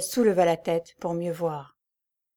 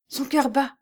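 high, sudden joyful cry; 6 semitones higher than usual. A narrator's reading. Jeanne feeling Lucie's heartbeat, a high joyful cry. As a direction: burst out in disbelieving joy. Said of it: "avec un cri de joie".

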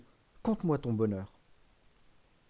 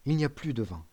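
ordinary delivery, nothing marked

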